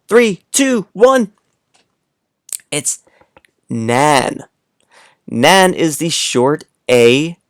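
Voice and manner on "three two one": excited countdown. Acted. Countdown for kids.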